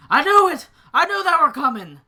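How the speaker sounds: full-volume, high-pitched acted scream. Game master as Lucian at the back door, screaming that they're coming. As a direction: scream it out at the top of your voice. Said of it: "he lets out a scream like".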